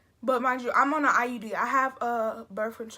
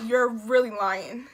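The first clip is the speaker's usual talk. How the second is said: mock disbelief; 8 dB louder, slower than usual. Telling the nurse she's lying.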